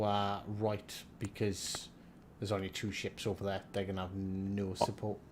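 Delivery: usual speaking voice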